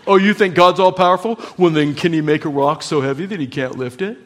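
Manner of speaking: fast, pushy mock gotcha question; smug challenger. A sceptic cornering a believer about God's power, smug challenge.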